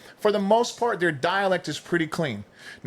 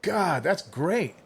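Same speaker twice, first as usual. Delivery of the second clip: slow, high fake delight; mock enthusiasm; 4 semitones higher, much slower than usual. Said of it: "I'm like".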